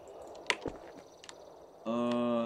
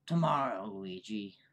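whispered sinister threat; unhinged villain; 5 semitones lower, 5 dB quieter than usual. Acted. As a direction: breathe the threat softly.

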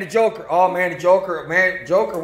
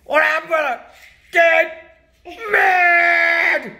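high, crying complaint, much higher; whiny hurt referee; 9 semitones higher than usual. Acted. Referee whining at the racers who keep crashing into him. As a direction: wail like a toy referee sick of being hit. Dad voices the knocked referee.

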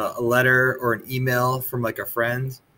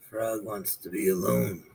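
lower, softer turtle; turtle's voice; 4 semitones lower, 8 dB quieter than usual. Acted. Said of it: "said the turtle".

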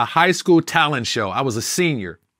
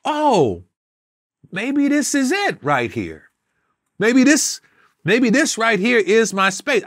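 higher, awed inner voice; a touch of wide-eyed discovery, pitched up; inner realization; 8 semitones higher, slower than usual. Retold or imitated. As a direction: voice the dawning thought with wonder. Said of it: "And it was like".

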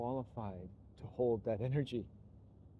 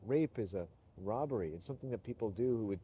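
usual speaking voice

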